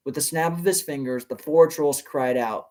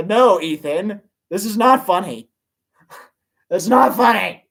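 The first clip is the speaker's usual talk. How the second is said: a laugh then a high, louder not funny; laughing protest; 6 semitones higher, 7 dB louder than usual. Real, not acted. Reader scolding a commenter, laughing. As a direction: protest while laughing in disbelief.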